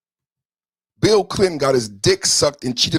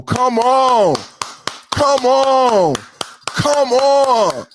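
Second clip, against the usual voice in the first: fired-up come on; 7 semitones higher, much slower than usual. Real, not acted. Streamer hyping his real talk.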